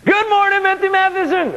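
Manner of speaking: a beaming doorman calling out hello; cheerful security guard. Acted. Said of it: "I hear Joe".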